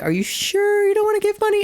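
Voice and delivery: very high squeaky mock voice; pleading fundraiser. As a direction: squeak it like a last desperate plea. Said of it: "And then you'd be like".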